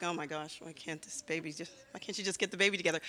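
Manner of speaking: low, hushed grumble; imitated annoyed churchgoer. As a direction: grumble softly under your breath, mildly annoyed. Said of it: "sometimes they're like".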